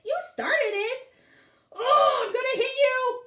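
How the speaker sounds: high loud tearful yell; crying yell. A narrator's reading. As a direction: shriek it, close to tears. Girl and Bradley fighting.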